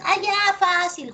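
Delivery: high-pitched mock student voice; imitating overconfident students. Teacher mimics students jumping to conclusions, mock overconfidence. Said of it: "Algunos chicos son medio loquitos y me dicen".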